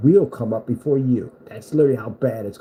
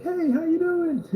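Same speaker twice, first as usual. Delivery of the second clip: high, slow sing-song greeting; a hammed-up cute kid voice; creepy friendly kid; slower than usual. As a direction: greet him sweetly but eerily. Paint-can kid greeting.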